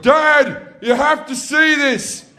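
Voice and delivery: put-on high, loud excited child; a kid begging dad to keep junk; child calling to dad at the tip, thrilled.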